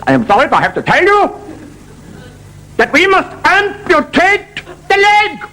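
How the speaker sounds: high, slow hammed-up German doctor; German accent. German doctor telling the patient about the leg, comic accent. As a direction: deliver bad news in comic stiff German. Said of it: "the doctor says".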